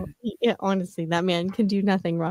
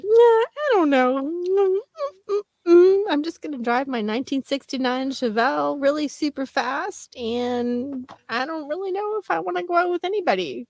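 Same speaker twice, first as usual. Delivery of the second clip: much higher, whiny mock-mom voice with whimpers; 8 semitones higher than usual. Mom brushing off stepdad's date offer, high whiny mock voice. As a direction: play the coy mom, whining and squeaky.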